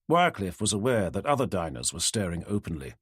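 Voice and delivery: plain reading voice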